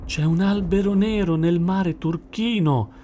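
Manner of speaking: high, mildly alarmed call; frightened cry. A narrator's reading. Dolphin suddenly spotting dark shape at sea, frightened cry. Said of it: "gridò spaventato d'un tratto il delfino".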